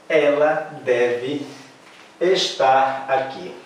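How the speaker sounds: slow, careful "Ela deve estar aqui"; slow model. Shown as a demonstration. Teacher to class, slow model sentence. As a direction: read the sentence slowly for the class. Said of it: "Então a frase é".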